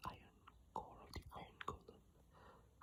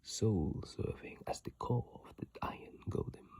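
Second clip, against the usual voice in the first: reading aloud in a whisper; 18 dB louder than usual. Real, not acted. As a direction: read the description solemnly in a whisper. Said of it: "Let's read the description".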